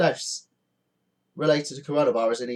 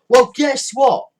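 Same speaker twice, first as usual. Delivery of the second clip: angry retort; 8 semitones higher, 3 dB louder than usual. Real, not acted. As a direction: snap back at them. Rebuking the holidaymakers.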